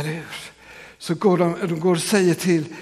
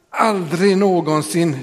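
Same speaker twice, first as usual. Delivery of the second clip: aldrig någonsin slowed and leaned on, louder; stress on aldrig någonsin; 3 semitones higher, 5 dB louder, slower than usual. Shown as a demonstration. Pastor stressing the double negation, slow and louder. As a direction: press hard on aldrig, then någonsin. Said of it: "dubbelnegation i grekiska texten".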